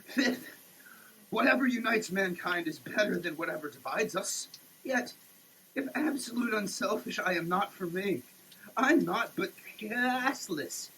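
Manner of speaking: ordinary talk, nothing marked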